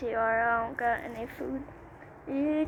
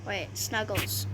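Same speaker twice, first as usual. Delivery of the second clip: normal talking voice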